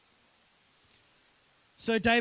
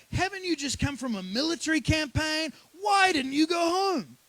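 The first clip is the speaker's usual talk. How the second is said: raised, high incredulous questioning; demanding king; 9 semitones higher, 6 dB louder than usual. Retold or imitated. David questions Uriah after he didn't go home, demanding. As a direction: voice a king pressing hard for answers, loud and high.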